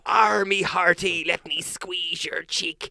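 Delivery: high, slow squawking pirate parrot. Pretend. Dad showing his child how pensioners sound, as a pirate parrot. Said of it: "the way you see the parrots on the TV".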